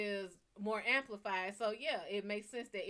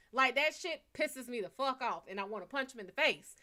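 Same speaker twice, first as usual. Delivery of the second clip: ordinary delivery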